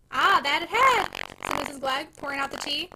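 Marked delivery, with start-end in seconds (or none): 0.1-1.1 emphatic agreement. Acted. Wife pleased at flattery.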